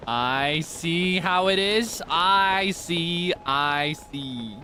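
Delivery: knowing mock voice. Knowing voice at game.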